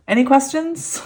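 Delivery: mock-innocent question after a giggle; playful tease. Real, not acted. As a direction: tease the viewers with a grin.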